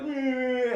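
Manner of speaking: high, held-out mock straining whine; straining prisoner noise. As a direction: imitate prisoners straining to reach. Imitating prisoners reaching through bars.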